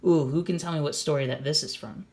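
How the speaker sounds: plain reading voice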